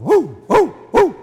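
loud, high imitated dog bark. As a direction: bark like a little dog behind the door. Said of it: "I heard the dog barking".

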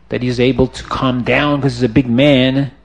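ordinary delivery

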